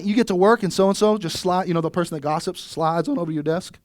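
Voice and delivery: ordinary delivery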